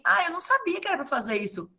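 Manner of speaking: put-on high, fast, whiny kid voice; mocking student excuse. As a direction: lightly mimic a lazy student. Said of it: "eles vão falar pra mim". (aluno se fazendo de desentendido, voz aguda e rápida)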